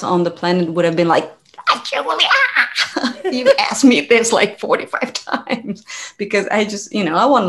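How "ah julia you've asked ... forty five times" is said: high exasperated cry breaking into laughter; mock losing patience, laughing; exasperated mock outburst. Imitating anyone else fed up with Julia, exasperated.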